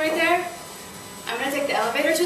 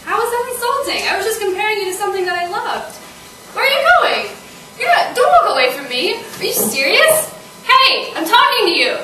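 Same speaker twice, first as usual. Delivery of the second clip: loud, high-pitched outrage; indignant shouting; 8 semitones higher, 9 dB louder than usual. Acted. Woman yelling at her date walking away, indignant. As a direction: yell after someone who is walking off.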